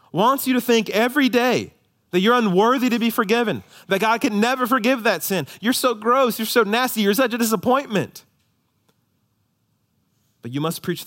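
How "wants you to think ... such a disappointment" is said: high, loud accusing voice of the inner critic; voicing the accuser. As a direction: hurl the accusations like the devil would.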